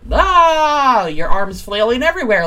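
hammed-up flustered outburst, high and loud; loud, high, arms-flailing mock freak-out. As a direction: wail it in mock exasperation, voice swooping up. Mimics being up in arms.

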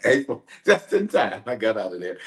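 laughing through the words, pitch lifting; amused laughing. Real, not acted. Telling viewers he left just in time, amused laughing. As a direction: chuckle it out with relieved glee. Laughs about escaping the cold.

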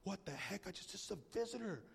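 quiet mock confusion; muttered aside. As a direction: mutter it to yourself. Guest thinking aloud.

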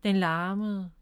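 usual speaking voice

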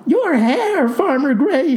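high-pitched mock bellow; bellowed horror. A narrator's reading. Clerk horrified at farmer's hair, bellowing. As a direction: cry out high, aghast, without real volume. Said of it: "she bellowed".

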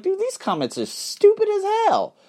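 exasperated quote. Retold or imitated. Mocking himself reading comments.

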